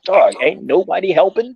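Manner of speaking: high, loud incredulous cry; disbelief. Retold or imitated. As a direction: ask it in shocked disbelief. Recalled disbelief at street fight.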